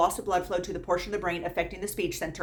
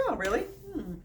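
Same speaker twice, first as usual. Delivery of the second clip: mock innocent question; 8 semitones higher than usual. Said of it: "I'm like".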